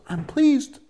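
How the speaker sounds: high-pitched, delighted exclamation as God; put-on voice leaping up high with approval; God, pleased. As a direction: play God happily announcing approval. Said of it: "God goes".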